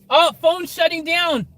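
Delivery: high, slow alarmed cry; sudden panic, drawn out. Real, not acted. Phone dying suddenly.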